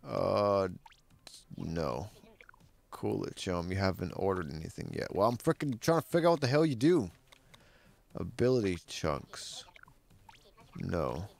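ordinary talk

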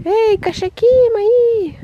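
high, loud, drawn-out call. Real, not acted. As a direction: call your cats from afar. Calling the cats.

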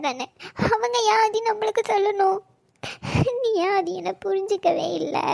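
hurt, choked pleading between sobs; tearful crying. Acted. Girl asking Aadhi why he misunderstood her, crying. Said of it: "அழுகாதடி பைத்தியக்காரி".

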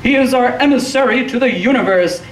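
high-pitched crazed proclamation; high, declamatory mad prophet; raving. A narrator's reading. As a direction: rave it like a man half off his nut.

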